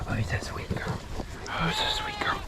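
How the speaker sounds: soft whispered cooing; whispering. Real, not acted. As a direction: whisper it tenderly to an animal. Cooing to a goat.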